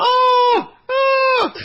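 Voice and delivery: very high, loud "oh, oh" of sudden recognition; surprised recognition. Retold or imitated. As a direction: squeal it like you just recognised someone. Cousin recognising him, surprised.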